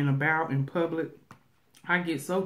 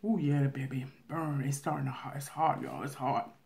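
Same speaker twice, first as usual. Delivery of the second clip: muttered aside; 2 semitones lower, 6 dB quieter than usual. Real, not acted. Aside about the heat.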